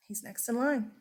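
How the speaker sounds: ordinary talk, nothing marked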